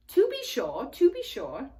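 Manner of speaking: a fussy, polite faun greeting; lightly voiced faun, eager.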